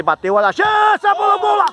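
rising sportscaster shout as the shot goes in; excited goal call. Real, not acted. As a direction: pitch leaping into a shout. Commentator calls a goal being scored.

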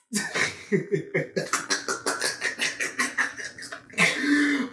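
helpless, drawn-out cackle; long laughing fit. Real, not acted. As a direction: crack up and keep laughing. Cracking up at own line.

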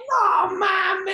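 helpless laughing yell; laughing shout. Retold or imitated. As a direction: yell it while cracking up on the floor. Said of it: "así decía".

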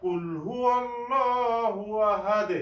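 slow, melodic chanted recitation; Quran recitation. Shown as a demonstration. Teacher reciting Sura Ikhlas for learners, chanted recitation. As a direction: recite with long drawn-out vowels.

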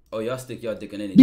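loud fast mocking imitation of the pimp; mocking quote. Streamer loudly mimics the pimp's statement for chat. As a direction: blurt it out loud and fast, making fun of him. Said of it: "he gonna make a statement about".

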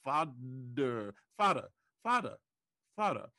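FODDER for father, with a D in the middle, the R fading away. Shown as a demonstration. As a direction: swap the TH for a D: FODDER. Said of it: "like father became".

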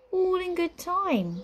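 high, slow, breezy brush-off; airy. A narrator's reading. Witch keeps her brother waiting, airy. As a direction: float it out unhurriedly. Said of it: "said the witch airily".

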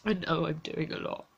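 quiet, tearful and worn out; exhausted, close to crying, barely above a murmur; near tears. Real, not acted.

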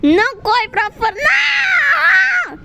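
crying panicked yell; panicked shout. Real, not acted. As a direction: yell in real fear. Panic chase.

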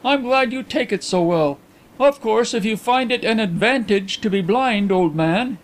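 high-pitched gentleman, sympathy cut short; awkward visitor. A narrator's reading. Visitor, sympathy rebuffed, answers blind friend, awkward. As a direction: concede it a little awkwardly.

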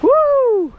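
ordinary talk, nothing marked

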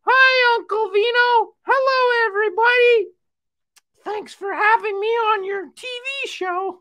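very high, loud puppet voice; high-pitched cartoon greeting; Marmalade. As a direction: squeal it out as an eager little puppet friend.

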